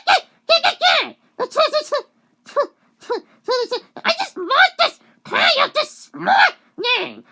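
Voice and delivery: high, loud acted shrieks; full-blown screechy character imitation; shrieking. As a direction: shriek it to drive pests away. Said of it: "and she shrieks".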